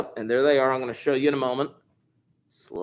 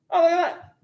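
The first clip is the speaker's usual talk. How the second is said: delighted surprise; 3 dB louder than usual. Real, not acted. Pleased at quiz answer.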